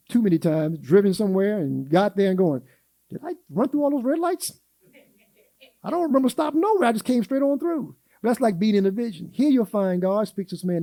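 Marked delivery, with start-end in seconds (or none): 3.1-7.9 higher, quicker puzzled self-questioning; half-amused disbelief at himself; mock bewildered driver; baffled wondering. Retelling own absent-minded driving.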